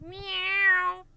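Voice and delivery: high, loud, stretched meow; weird meow. Pretend. Host puzzled by her own cat noises, weird meow. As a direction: swoop up into a long cat cry. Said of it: "I don't know why I'm meowing weird".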